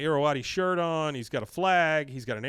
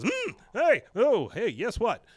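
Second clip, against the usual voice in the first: startled; 8 semitones higher, 4 dB louder, slower than usual. Retold or imitated. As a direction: caught off guard, much higher. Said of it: "Like, she's startled".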